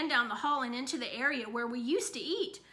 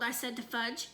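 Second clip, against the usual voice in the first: plain reading voice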